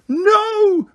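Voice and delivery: very high, piercing shriek. A narrator's reading. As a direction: shriek in terror. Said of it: "he heard Umbridge shriek".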